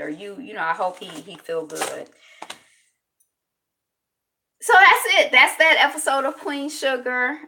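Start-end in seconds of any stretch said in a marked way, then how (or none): 4.6-7.4 high, loud wrap-up; energetic show outro; upbeat sign-off. Real, not acted. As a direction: announce the end with a burst of energy. Wrapping up the show after pause.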